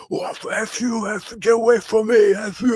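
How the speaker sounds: high, strained imitation of furious yelling; mock shouting. Retelling how the rival started shouting at him, mock yelling. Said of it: "he starts shouting at me like".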